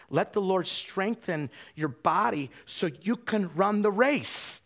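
building to a loud, high peak; slow, emphatic build to a ringing finish; rousing exhortation. Real, not acted. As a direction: drive it upward into a preacher's cry. Preacher builds to exhortation climax.

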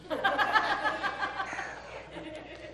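real burst of high laughter. Real, not acted. Laughs at pastor's punchline.

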